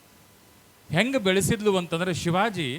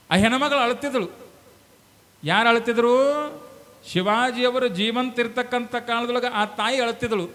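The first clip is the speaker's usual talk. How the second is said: high, loud declamation; full-force preaching; 8 semitones higher, 6 dB louder than usual. Real, not acted. Preacher returns to the weeping mother's tale, in full-force preaching. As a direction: call the story out to the crowd.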